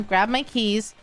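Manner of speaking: ordinary delivery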